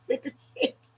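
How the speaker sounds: breathy laugh through Fifty-six; amused, slow laughing reply. Real, not acted. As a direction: chuckle the answer out. Laughs at own age mix-up.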